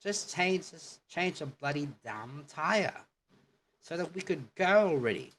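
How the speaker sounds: a hammed-up impatient Brit about the tyre; exasperated British demo line; British accent. Shown as a demonstration. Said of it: "let's translate it in American".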